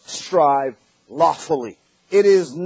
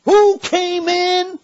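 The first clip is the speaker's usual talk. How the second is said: fiery preaching; 8 semitones higher than usual. Acted. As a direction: press the congregation hard with each question. Preaching at full force.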